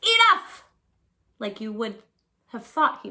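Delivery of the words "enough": Enough! yelled high and loud; shouted enough. Shown as a demonstration. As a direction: belt out Enough! like a fed-up kid. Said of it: "Only he didn't say".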